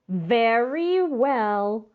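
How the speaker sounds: slow, drawn-out call. Acted. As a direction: call "Very well" slowly across the snow. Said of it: "called Grandma Tildy".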